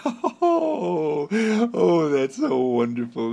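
high, loud laughing delight; laughing with wonder at it all; joyous laughing. Shown as a demonstration. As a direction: gush through laughter. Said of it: "a laugh that comes deep from down inside of you and says".

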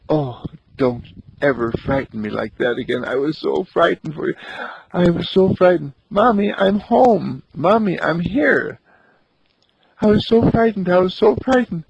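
tremble with relief; mother clutching her child, shaken and frightened. Acted. Mother hugs her found child.

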